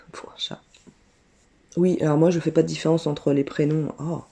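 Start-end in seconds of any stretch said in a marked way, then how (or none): 0.0-0.6 whispered laugh. Real, not acted. Amused aside.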